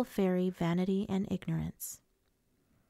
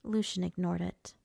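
ordinary delivery, nothing marked

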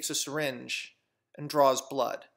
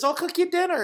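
high, lilting offer, much louder; sing-song mock invitation; acted dinner invitation; 7 dB louder than usual. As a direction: pitch the invitation way up. Jamaican lady's dinner offer acted.

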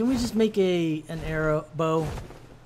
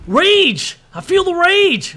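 hammed-up high, loud roar, slow on the second line; mock rage; 9 semitones higher, 7 dB louder, much slower than usual. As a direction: play-act fury at the game. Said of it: "Okay, I'm not really raging".

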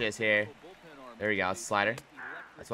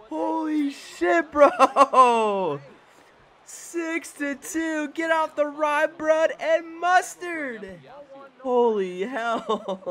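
high, slow, stretched-out cries with laughter; high-pitched home-run celebration; elated disbelief; 12 semitones higher, 3 dB louder, much slower than usual. Real, not acted. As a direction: draw every word out in giddy amazement. Still celebrating the grand slam.

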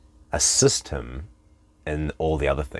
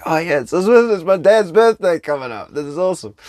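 high, loud mock delight; delighted realization; 12 semitones higher, 7 dB louder than usual. Coach reacting to Facebook birthday reminder, high hammed-up delight. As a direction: exclaim it as a happy sudden reminder.

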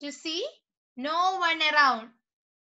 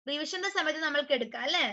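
ordinary talk, nothing marked

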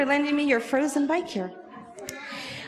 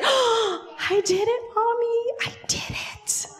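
high, breathy delighted disbelief; amazed gasp; 8 semitones higher, 4 dB quieter than usual. Acted. Girl stops at the tree, amazed gasp. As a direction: gasp it out, fading toward a whisper. Child amazed at own success.

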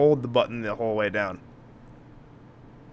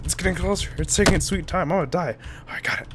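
ordinary talk, nothing marked

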